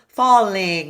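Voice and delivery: "Falling" said loud, ng resonating in the nose; ng through the nose. Shown as a demonstration. Coach shows the nasal ng in falling. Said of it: "you'll know you're making that sound".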